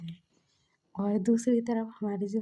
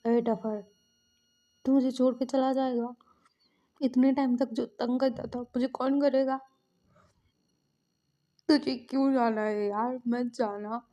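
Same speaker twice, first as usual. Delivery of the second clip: louder, slightly raised pleading; hurt protest; 3 semitones higher, 5 dB louder than usual. Retold or imitated. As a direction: protest like a brother being left behind. Brother pleads with departing sibling. (शिकायत भरी मिन्नत)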